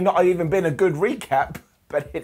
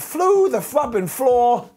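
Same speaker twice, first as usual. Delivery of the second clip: slow dramatic emphasis; 7 semitones higher, 4 dB louder, much slower than usual. Acted. Dramatic sky-high fall recap.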